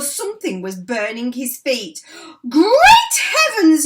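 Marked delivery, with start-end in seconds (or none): 2.5-3.8 very high, loud and slow exclamation; crying out. A narrator's reading. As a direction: burst out in astonishment. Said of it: "he cried".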